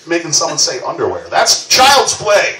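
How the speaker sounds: usual speaking voice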